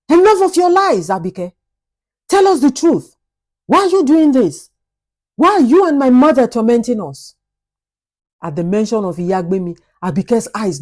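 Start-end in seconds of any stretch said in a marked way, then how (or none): 0.1-7.4 angry demand. Acted. Husband confronting the maid about his mother, raised and furious. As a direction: demand the truth, voice raised in anger. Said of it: "his eyes blazing with anger".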